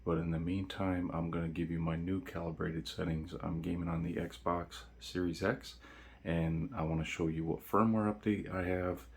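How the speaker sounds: quiet at night. Real, not acted. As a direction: keep it low, someone's asleep nearby. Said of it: "the reason why I'm talking pretty quiet".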